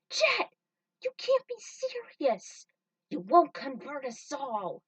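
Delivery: shocked outcry. Acted. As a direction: scream it as if betrayed by a friend. Faye shocked at Jet's betrayal.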